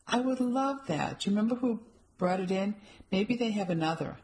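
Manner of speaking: high, eager flirty gushing; sweetly gushing over the pin. Acted. Meg flirts to get information.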